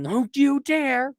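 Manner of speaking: high, slow mock warning; scolding sister. Sister scolding her brother, high and slow. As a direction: drag it out like a stern big sister.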